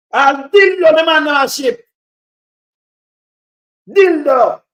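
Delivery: slow, loud, scornful shout of the word; loud outburst. Real, not acted. Caller rants to host about shipped goods, shouting. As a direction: bellow it out in disbelief.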